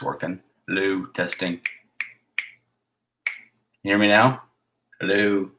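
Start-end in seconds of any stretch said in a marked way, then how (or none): none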